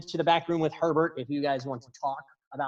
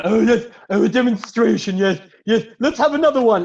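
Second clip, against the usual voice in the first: high, loud gleeful cry; excited onlooker, pitched up and loud; eager spectator voice; 6 semitones higher, 7 dB louder than usual. Acted. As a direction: cheer the act on in a high put-on voice. Crowd voice at magic show.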